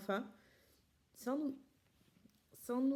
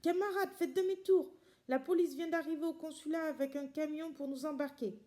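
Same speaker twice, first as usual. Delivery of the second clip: high, loud shout to fleeing comrades; shouting warning; 7 semitones higher, 5 dB louder than usual. A narrator's reading. Fleeing refugee shouting a warning to comrades, loud and high. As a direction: yell it out while running to warn them. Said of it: "en criant".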